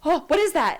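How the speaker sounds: high mock-panicked questions; high startled blurting; startled sleeper. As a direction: imitate someone jolting awake in alarm. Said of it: "he'll sit up and he'll go".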